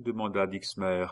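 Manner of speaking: ordinary delivery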